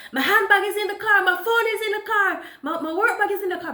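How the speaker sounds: high, loud and fast shout after the car; retold frantic shout about her bags. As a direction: yell after the departing car. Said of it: "when I shouted at him".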